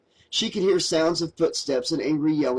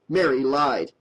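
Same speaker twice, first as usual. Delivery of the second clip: ordinary delivery, nothing marked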